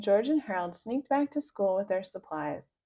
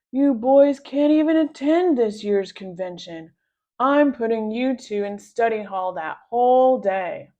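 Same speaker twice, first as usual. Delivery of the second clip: lightly voiced gloating principal, louder; gloating Mister Krupp; 2 semitones higher, 8 dB louder than usual. A narrator's reading. Mister Krupp gloats at the boys, loud triumphant voice. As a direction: crow over the boys' punishment. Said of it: "laughed Mister Krupp".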